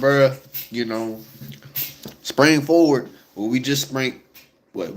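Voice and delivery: normal talking voice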